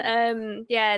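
ordinary talk, nothing marked

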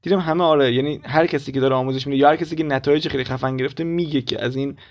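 ordinary talk, nothing marked

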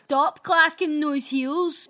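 loud, slow, drawn-out scolding; neighbour's complaint. Retold or imitated. Correcting her impression of the complaining neighbour, loud slow scolding. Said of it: "she probably said it like".

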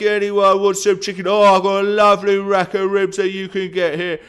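loud, high market stall shout; butcher. Acted. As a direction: hawk it like a farm shop butcher. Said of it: "I sound like a butcher. You know, those ones in the farmhouse".

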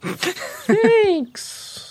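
high, drawn-out little sloth voice; tiny sloth child, very high and slow; slow baby sloth. Acted. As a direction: stretch it out like a sleepy-paced baby sloth. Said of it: "You talk really slow".

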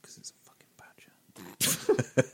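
slow, hushed whisper; slow whispered matter-of-fact answer; deadpan whisper. Real, not acted. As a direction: whisper it slowly like it's obvious.

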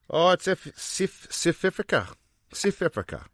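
high, clipped mock South African vowels; South African accent. Joking about South African listeners, mock South African accent. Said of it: "That was good, man".

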